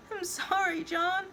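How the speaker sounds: high-pitched whimpering apology; tearful cry. A narrator's reading. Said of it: "she cries".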